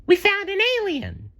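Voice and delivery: very high, thrilled cartoon sponge; excited exclaim. A narrator's reading. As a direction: shout out the discovery, squeaky and thrilled. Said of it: "exclaimed SpongeBob".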